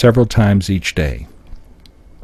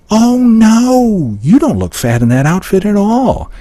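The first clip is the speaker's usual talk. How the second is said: high, exaggerated sarcastic reassurance; dripping with mock reassurance; 9 semitones higher than usual. Shown as a demonstration. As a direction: draw out a high-pitched, fake "oh, no". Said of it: "throwing out sarcastic things".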